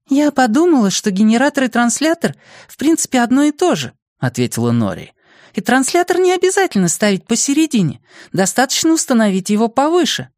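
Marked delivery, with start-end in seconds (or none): 0.1-3.9 put-on very high-pitched girl's voice; high girlish explanatory voice; girl explaining. A narrator's reading. Voices Nori explaining her idea. 5.5-10.3 put-on very high-pitched girl's voice; high girlish explanatory voice; girl explaining. A narrator's reading. Voices Nori explaining her idea.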